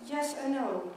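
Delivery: usual speaking voice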